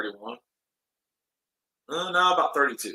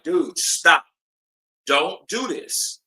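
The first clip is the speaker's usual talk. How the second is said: louder, higher, slow firm warning; retold firm warning; 4 semitones higher, 5 dB louder, much slower than usual. Telling his drunk friend to stop, firm and raised. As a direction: stop him squaring up.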